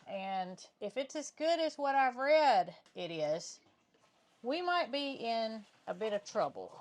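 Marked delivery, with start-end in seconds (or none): none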